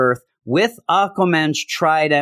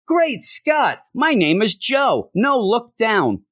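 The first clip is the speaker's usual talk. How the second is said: mock banter; 9 semitones higher, slower than usual. Parodying silly comic banter.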